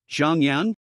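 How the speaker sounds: ordinary delivery, nothing marked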